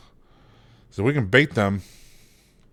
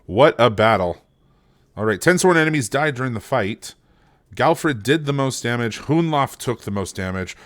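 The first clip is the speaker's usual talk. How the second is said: normal talking voice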